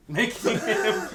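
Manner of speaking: reading through giggles; words cracking into laughter; laughing. Real, not acted. Reader losing it mid-sentence, laughing.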